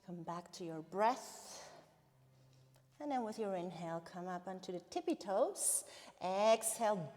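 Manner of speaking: usual speaking voice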